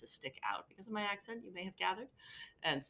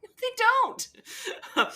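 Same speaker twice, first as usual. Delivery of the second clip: high, laughing "No, they don't"; laughing retort; 9 dB louder, much slower than usual. Retold or imitated. Teacher retelling her reply to the fake Brit, laughing. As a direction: laugh through the flat denial, much higher. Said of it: "I'm like".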